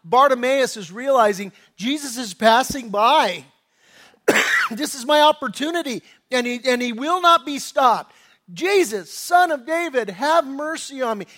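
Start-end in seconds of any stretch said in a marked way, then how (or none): 1.8-6.0 louder, higher cry of sudden realization; mildly shouted, seizing the chance; urgent cry. Acted. Bartimaeus realizing Jesus is passing by, urgent cry. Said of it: "you're just screaming out". 8.5-11.3 higher, louder acted cry for mercy; desperate scream. Bartimaeus begging Jesus for mercy, mock desperate scream. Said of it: "He's screaming it like a wild animal".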